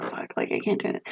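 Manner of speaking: a soft whisper, almost holding back tears; whispered despair. Retold or imitated. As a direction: whisper it like you're about to break. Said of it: "So I was like".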